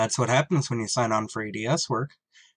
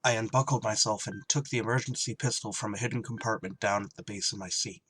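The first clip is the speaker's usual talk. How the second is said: story narration; 2 semitones lower, 6 dB quieter than usual. A narrator's reading. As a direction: tell it like a tense memory. Pilot narrates the discovery.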